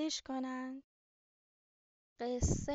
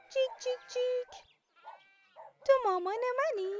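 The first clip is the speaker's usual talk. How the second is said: high, loud chirpy chick voice cheeping its question; a tiny chick calling for its mum, higher and louder; little lost chick; 9 semitones higher, 6 dB louder than usual. Acted. Lost chick asks the cow for its mum, high chirpy cheep. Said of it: "ازش پرسید".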